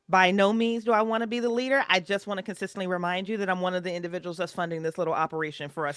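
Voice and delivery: usual speaking voice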